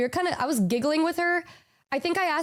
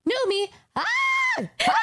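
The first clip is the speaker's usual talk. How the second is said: high surprise breaking into little screams; imitated shocked realization; 14 semitones higher than usual. Henya realizing it is her friend, imitated shocked squeals. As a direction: act out a dawning, squealing recognition. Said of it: "as they were screaming".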